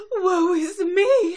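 high, loud, slow tragic cry; melodramatic diva wail. Acted. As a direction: wail it like a stage heroine. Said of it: "And Rarity is Rarity".

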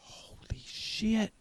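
slow, hushed amazement; stunned whisper. Acted. Eddie hearing the year, slow stunned whisper. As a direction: breathe it out slowly, stunned.

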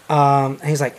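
ordinary delivery

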